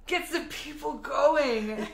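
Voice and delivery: slow drawn-out hype line; catchphrase voice. Retold or imitated. Quoting the hype line, slow and drawn out. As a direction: stretch it out like a catchphrase.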